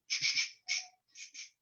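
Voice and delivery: soft, whispered shh shh shh; quiet unvoiced shushing; gentle shushing. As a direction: hush a child gently. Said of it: "no le dice".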